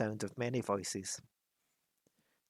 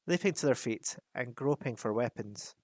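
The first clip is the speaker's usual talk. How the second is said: plain reading voice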